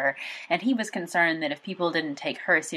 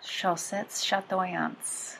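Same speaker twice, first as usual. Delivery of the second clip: "Chaussettes Chatoyantes" in slow, halting, anglicised French; tourist with phrasebook; 2 semitones higher, 4 dB quieter, much slower than usual. Shown as a demonstration. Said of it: "I'm sorry for my bad French".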